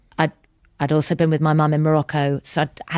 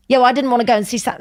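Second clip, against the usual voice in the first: mock petulant comeback, higher, louder and fast; childish retort; 8 semitones higher, 4 dB louder, much faster than usual. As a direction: sulk it back like a child. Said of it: "something really childish".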